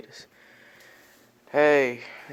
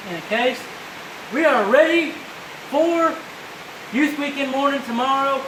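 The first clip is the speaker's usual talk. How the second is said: screaming over rain; 7 semitones higher than usual. Real, not acted. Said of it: "It's probably why I'm screaming".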